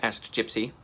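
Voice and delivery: plain reading voice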